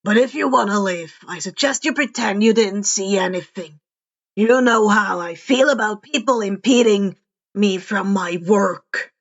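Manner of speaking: a hint of a taunting killer, pleased with himself; menacing villain. A narrator's reading. Stain taunting the young vigilante, lightly menacing. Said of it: "Stain said".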